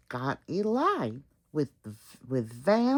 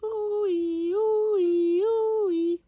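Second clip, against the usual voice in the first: high loud siren ooh-ee; siren wail; 14 semitones higher, 5 dB louder, slower than usual. Acted. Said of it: "went a siren".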